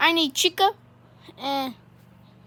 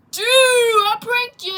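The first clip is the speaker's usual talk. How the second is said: high, loud, slow taunt; drawn-out triumphant yell; gloating shout; 8 semitones higher, 6 dB louder, slower than usual. Acted. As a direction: crow over a successful prank. Gloating over the prank.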